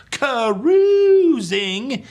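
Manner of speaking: high, loud, stretched-out exclamation; showy catchphrase. Pretend. Host signing off for jazz hand lovers, showy and loud. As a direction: hold the middle vowel high and loud.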